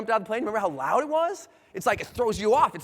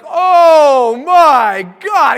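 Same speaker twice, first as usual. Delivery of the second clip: loud, drawn-out cry of dread; mock terror; 3 semitones higher, 14 dB louder, much slower than usual. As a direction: wail it out loud in acted horror. Terrified at the plane door.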